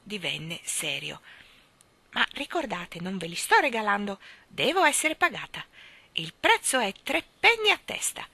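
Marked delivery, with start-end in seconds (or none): 2.1-8.3 higher crone voice turning firm; serious old Mrs Corey. Acted. Old shopkeeper reminds the children they must pay. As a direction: insist on payment firmly.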